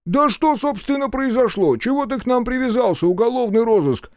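high-pitched, aggrieved protest; exasperated outburst. A narrator's reading. Held policeman demanding what this is about, exasperated. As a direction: burst out high, unable to take it. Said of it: "не выдержал он".

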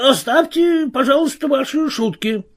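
high-pitched character exclamation; exclaiming. A narrator's reading. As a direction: cry it out high, pleading for the joking to stop. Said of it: "воскликнул Томас Марвел". (взволнованное восклицание высоким голосом)

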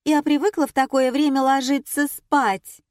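high, loud spoiled complaint; whiny spoiled pouty drawl. Acted. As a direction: complain like a pampered star. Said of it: "закапризничала Светлана". (капризно и обиженно)

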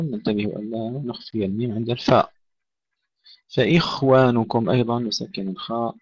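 ordinary delivery

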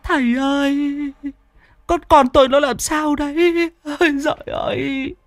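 very high, tearful pleading; a mother begging the master about her son; high whimpering lament; wailing mother. Acted. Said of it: "Bà Tính mếu máo".

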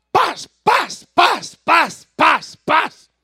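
seis disparos gritados muy alto y agudo; disparos imitados. Acted. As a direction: imita los balazos a gritos. (disparos imitados a gritos, muy agudos)

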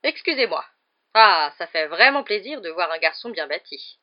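loud, boorish aunt; tante Marge. A narrator's reading. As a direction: voice a self-satisfied guest holding forth loudly. Narrator voices aunt Marge. (tante sans gêne, voix forte)

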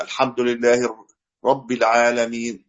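رب with the ba doubled, slow and careful; shadda stressed. Shown as a demonstration. Teacher reciting slowly to show the shadda. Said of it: "لابد يقرأها كما أنزلت".

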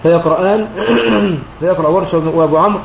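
ordinary talk

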